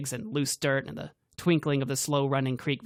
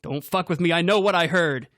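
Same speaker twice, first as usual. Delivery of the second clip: loud, higher furious yell; angry yell; 5 semitones higher, 7 dB louder than usual. A narrator's reading. Grant yelling at Cassidy, loud and furious. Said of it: "Grant yelled".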